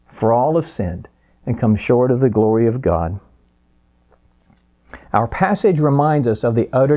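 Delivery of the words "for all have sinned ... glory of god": ordinary talk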